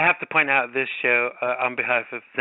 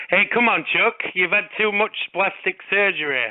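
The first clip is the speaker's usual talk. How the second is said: playful put-on Lancashire accent, pitched higher; mock Lancashire accent; 5 semitones higher than usual. Host teasing his co-host about surgery, in a mock Lancashire accent. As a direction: tease a friend in a hammed-up Lancashire accent. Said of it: "that's my mock Lancashire accent".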